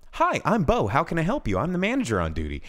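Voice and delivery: high, bright customer-service voice; hammed-up chipper manager; fake cheery manager. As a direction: greet her with exaggerated sweetness, mocking. Mock cheerful manager greeting.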